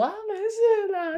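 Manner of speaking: 高八度拖长，装作若无其事; 假装没事地轻飘飘一句; fake breezy denial. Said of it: "好像装作". (模仿对方装没事，又高又慢)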